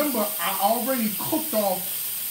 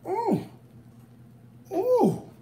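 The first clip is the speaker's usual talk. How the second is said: delighted squeal; 11 semitones higher than usual. Real, not acted. Sudden delighted squeal at food.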